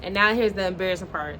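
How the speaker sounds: ordinary delivery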